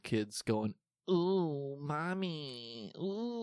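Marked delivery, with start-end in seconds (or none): 1.1-3.4 put-on high, thin whiny child voice; pinched, eerie little kid calling mommy; creepy kid voice. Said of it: "That's my, that's my kid's voice".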